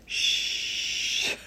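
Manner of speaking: whispered shh; a long breathy shush like the whole class; imitating the kids hushing; children's shush. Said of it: "And they all go".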